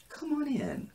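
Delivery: high, teasing singsong taunt; mock-sweet invitation. Woman leaves her door open for her rival, high mock-sweet taunt. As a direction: pitch it way up as a catty dare.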